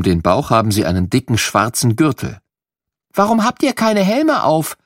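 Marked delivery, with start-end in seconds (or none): 3.1-4.8 higher, lightly voiced small child's question; little girl asking. Little girl asking the firemen about helmets, curious child voice. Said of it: "fragt Lilli".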